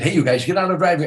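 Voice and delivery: loud, high, fast warning yell; urgent shout. Retold or imitated. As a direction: shout them out of the way, quickly. Warning comedians in driveway.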